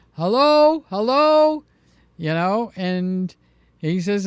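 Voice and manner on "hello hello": put-on loud, high old man's hello into the receiver; grandfather's loud hello. Grandson mimics grandfather shouting into an old phone. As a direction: call hello down the line like grandpa.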